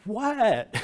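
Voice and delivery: high, loud, baffled yelp of disbelief; stunned what. Retold or imitated. Retelling his reaction to the compliment, a loud stunned yelp. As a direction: blurt it out, completely thrown.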